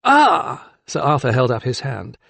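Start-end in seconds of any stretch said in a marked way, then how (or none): 0.0-0.7 high, loud "ah"; interrupting. A narrator's reading. Magistrate stopping the keeper, interjecting high. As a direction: cut in to halt an outburst. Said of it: "to stop the keeper from any further outburst".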